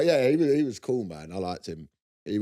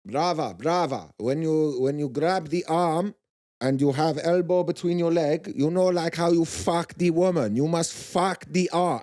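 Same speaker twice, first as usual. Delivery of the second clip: imitating a Brazilian accent, louder and higher; Brazilian coach accent; 6 semitones higher, 5 dB louder than usual. Coach teaching arm bars on the mats, Brazilian accent. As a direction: bark arm-bar advice in a Brazilian accent. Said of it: "and he's going to me".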